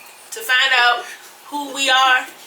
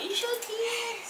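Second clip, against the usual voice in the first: 1 mocking guests' question; 13 semitones higher, 12 dB quieter, slower than usual. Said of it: "I got a lot of questions".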